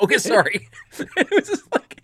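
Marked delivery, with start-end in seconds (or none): 0.0-0.7 high, loud mock apology; a cowed quick sorry; mock sheepish Harry. Harry apologizes to Hermione, mock sheepish. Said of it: "He's like".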